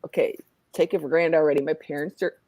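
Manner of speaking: ordinary talk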